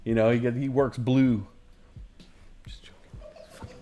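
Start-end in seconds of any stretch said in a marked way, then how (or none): 2.7-3.8 breathy, slow whisper; whispered aside. Real, not acted. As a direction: tuck it away as a secret. Aside after a joke.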